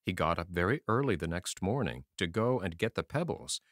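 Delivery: usual speaking voice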